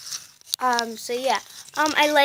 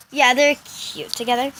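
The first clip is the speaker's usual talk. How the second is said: ordinary talk, nothing marked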